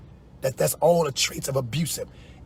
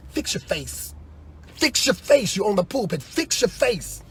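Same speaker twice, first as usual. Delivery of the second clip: friend's scolding; 3 semitones higher, 4 dB quieter than usual. Retold or imitated. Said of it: "my friend Linda used to say".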